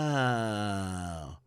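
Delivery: long, low, soft wow; stunned. Real, not acted. Reacting to the eighteen hours testimony, stunned and drawn out. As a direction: let it sink slowly out, quietly stunned.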